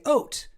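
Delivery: "oat" for out, with a long O; Nova Scotian "out". Shown as a demonstration. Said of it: "Nova Scotians say oat".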